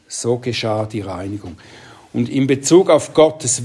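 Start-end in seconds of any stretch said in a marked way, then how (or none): 0.1-1.6 whisper. Real, not acted. Preacher to congregation after the Titus verse, hushed whisper. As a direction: drop to a soft unvoiced whisper.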